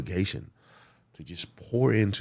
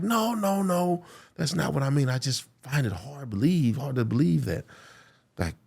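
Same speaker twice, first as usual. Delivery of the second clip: high pleading teacher's reassurance; earnest insistence; 8 semitones higher than usual. Retold or imitated. Teacher reassuring the prisoner, high earnest insistence. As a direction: press it, voice lifted high.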